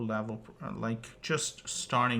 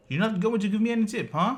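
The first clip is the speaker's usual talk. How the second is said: faster, higher and louder, pressing the point; indignant demand; 8 semitones higher, 6 dB louder, faster than usual. Retold or imitated. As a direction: push the question again, fast and high. Driver repeats demand.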